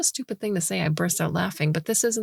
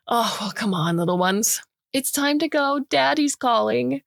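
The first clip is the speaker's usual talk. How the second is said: put-on higher, sing-song parent calling kids; mock daddy call; 6 semitones higher than usual. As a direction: herd them like little kids. Calls group like children.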